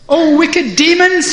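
high, loud storyteller's threat; defiant challenge. A narrator's reading. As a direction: call out the enemy with bold bluster. Acted challenge to the demons.

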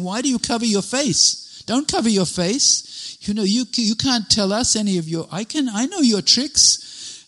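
high, loud accusing shout; furious scolding mother. Retold or imitated. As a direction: scold him like an enraged mother. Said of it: "and she's furious".

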